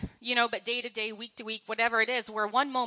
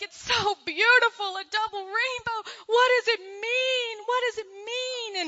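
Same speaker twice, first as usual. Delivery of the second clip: high, drawn-out mock weeping; hammed-up overwhelmed wail, near tears with wonder; imitated crying and laughing; 9 semitones higher, 3 dB louder than usual. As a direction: imitate a man sobbing in awe. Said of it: "he's crying and he's laughing and he's like".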